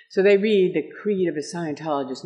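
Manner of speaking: ordinary delivery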